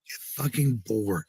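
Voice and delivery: ordinary delivery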